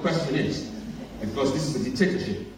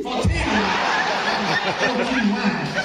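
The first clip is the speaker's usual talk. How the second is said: put-on African accent; 4 semitones higher, much slower than usual. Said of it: "repeat the question in an African accent".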